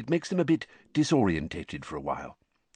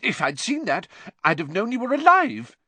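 high, anguished outburst, rising louder; worked-up, emotional wife; overwrought mother; 12 semitones higher, 5 dB louder than usual. A narrator's reading. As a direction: wail it with regret and relief. Upset mother resumes after spluttering.

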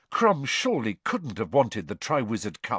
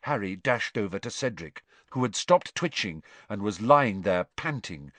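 ordinary delivery, nothing marked

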